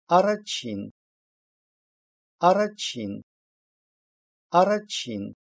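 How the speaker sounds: "арачин" with a voiceless "ч" inside; "ч" mid-word. Shown as a demonstration. Teacher giving an example for learners, "ч" in "арачин". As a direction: say "арачин" distinctly, voiceless ch before "ин". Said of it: "иногда произносится «ч»".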